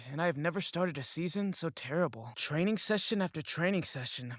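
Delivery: plain reading voice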